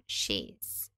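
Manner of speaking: "she's" with a soft sh and a z ending. Shown as a demonstration. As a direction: glide "she's" sh-ee-z. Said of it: "forma contracta do pronome she".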